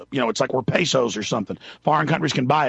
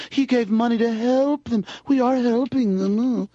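mocking media voice; 9 semitones higher, slower than usual.

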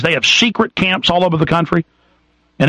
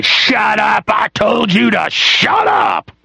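mock drill-sergeant yell, drawn out and higher; imitated screaming cop; 5 semitones higher, much slower than usual. Host mimics cops screaming at him, mock shouting. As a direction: imitate an officer yelling an order. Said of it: "start screaming at me".

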